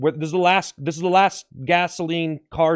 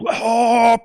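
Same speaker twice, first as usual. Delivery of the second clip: volcano erupting laugh; 8 semitones higher than usual. Pretend. Volcano erupting for the last time, triumphant cackle. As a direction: burst open into a big staccato cackle. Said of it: "a volcano getting the last eruption".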